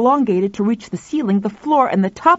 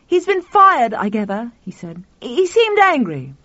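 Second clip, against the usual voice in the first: 1 excited; 5 semitones higher, 3 dB louder than usual. A narrator's reading. Tuffy in excited high voice.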